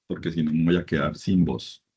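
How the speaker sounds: normal talking voice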